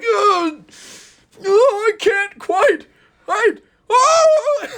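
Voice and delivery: very high, rising to a panicked yelp; straining cry. Acted. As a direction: stretch slowly, then lose it with a yelp. Booster straining, then falling off.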